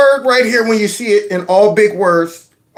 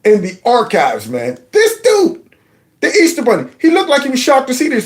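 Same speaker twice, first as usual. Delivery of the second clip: ordinary talk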